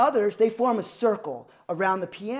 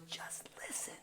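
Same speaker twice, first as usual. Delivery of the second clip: mouthed whisper; 21 dB quieter than usual. Retold or imitated. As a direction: mouth it across the piano without voice. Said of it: "He's mouthing the words".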